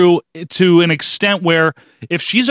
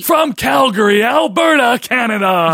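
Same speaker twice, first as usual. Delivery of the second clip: ring announcer; 8 semitones higher than usual. Retold or imitated. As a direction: mimic the announcer calling the hometown. Imitating Bill Dunn's intro.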